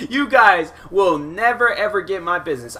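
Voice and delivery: amused retold vow with a laugh. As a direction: chuckle through the threat, dragging it out. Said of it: "I'm like".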